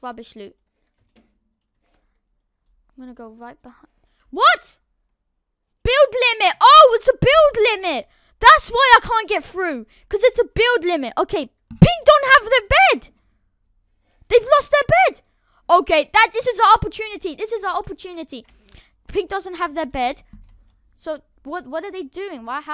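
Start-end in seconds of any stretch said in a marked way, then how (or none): 4.3-4.8 sharp, high, loud startled what; surprised. Real, not acted. Gamer startled at a block that won't place, surprised. 5.8-11.1 higher, louder exclaiming; suddenly gets it, out loud; excited realisation. Real, not acted. Gamer working out why he can't get through, excited. 11.2-18.4 high, loud eager announcing; excited. Real, not acted. Gamer spotting the enemy team lost their bed, excited.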